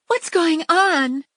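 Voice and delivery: a startled housewife calling out; wife calling from the doorway, high and drawn out; wife's alarmed question. A narrator's reading. Missus Jug pops out.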